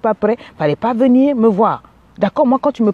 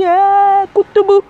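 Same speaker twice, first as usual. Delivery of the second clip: high, louder indignant cry; outraged exclamation; 10 semitones higher, 5 dB louder, slower than usual. Real, not acted. Coach reacting to a woman who accepts beatings, outraged. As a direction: burst out in disbelief.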